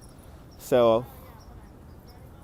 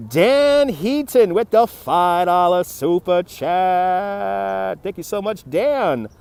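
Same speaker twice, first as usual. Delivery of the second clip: drawn-out, slow showy announcer call; super chat shout-out; much slower than usual. Pretend. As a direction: stretch it out like announcing a big donor. Announcing a viewer's donation.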